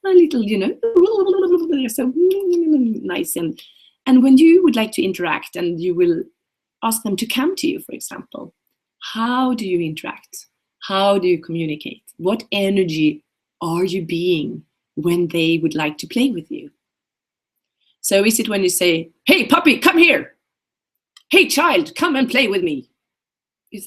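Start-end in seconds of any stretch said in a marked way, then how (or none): 0.1-3.3 cooing at a puppy. Pretend. Cooing at imagined little puppy. 19.3-22.9 high, loud eager call to a puppy; sing-song beckoning, louder and much higher; calling out eagerly. Pretend. Coach calling an imagined puppy over, high and loud.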